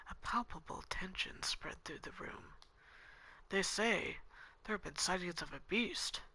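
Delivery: soft, even narration over music; quiet visual novel reading; story narration. A narrator's reading. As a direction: read the scene calmly, softly. Reads game narration and dialogue.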